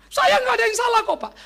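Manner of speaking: high, loud mock protest; hammed-up indignant wife voice. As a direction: act a wife insisting on her innocence.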